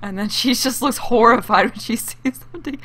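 giggling while describing it; laughing. Real, not acted. Streamer describing her Sim's horrified look, giggling. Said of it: "I find that funny".

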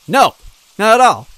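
high, loud frightened cry; shaky fear. A narrator's reading. Luigi admitting fear to Goombella, high frightened cry. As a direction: blurt it out terrified. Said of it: "I replied shakily".

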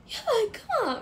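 high, loud startled shriek of fear; panicked scream. Retold or imitated. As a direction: yell it as if a bear is right there. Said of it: "みたいな言ってたら". (熊を見たと思って叫ぶ驚きの悲鳴)

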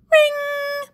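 high ringing bell call; bell imitation. A narrator's reading. Narrator imitates the lunch bell, ringing. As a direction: ring out like a school bell.

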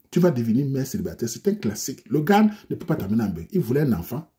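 usual speaking voice

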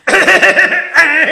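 high squealing giggle; high squealing laugh. Real, not acted. Man laughing at his own story, squealing high.

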